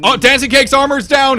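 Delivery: sudden high excited call; excited shout. Real, not acted. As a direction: shout it as the armor drops. Caster sees sudden armor loss.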